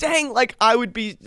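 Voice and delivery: high excited dang; lightly voiced amazement; mock impressed. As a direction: gush at how good fourth is. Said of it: "I'm like".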